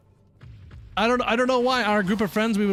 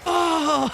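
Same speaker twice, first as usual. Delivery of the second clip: very high stunned "Oh!"; a pitched-up gasp of disbelief; shocked cry; 10 semitones higher, 3 dB louder than usual. Retold or imitated. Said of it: "looks up at me and goes".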